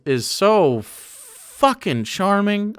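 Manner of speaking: drawn-out fangirl swoon; gushing. Real, not acted. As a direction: swoon over him, stretching every word. Gushing over character.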